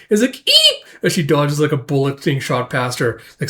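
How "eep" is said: very high, loud mock squeak; startled squeal. Woman dodging a shot, startled squeal. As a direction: yelp as if dodging a bullet.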